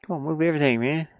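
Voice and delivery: ordinary talk, nothing marked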